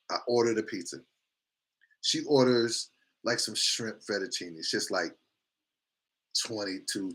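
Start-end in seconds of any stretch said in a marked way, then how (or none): none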